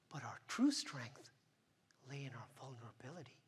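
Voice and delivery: soft whispered reveal; whispering. Acted. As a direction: whisper the key line. Speech's key message whispered.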